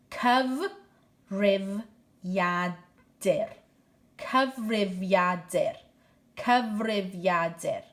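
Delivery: Cyfrifiadur said slowly, syllables spread; slow model word. Shown as a demonstration. Teacher drilling Cyfrifiadur slowly for pupils. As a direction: draw out Cyfrifiadur for learners to copy. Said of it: "teacher models word repeatedly".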